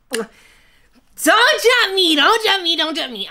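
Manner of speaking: high drawn-out don't judge me, fading; mock pleading. Begging the viewers not to judge, mock whine. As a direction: whine it like a playful plea. Pleading playfully about [name] opinion.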